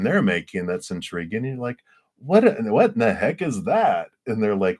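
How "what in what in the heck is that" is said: high, louder burst of intrigued surprise; mock amazed curiosity. Potter spots intriguing work nearby, acted amazement. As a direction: play up being floored by curiosity.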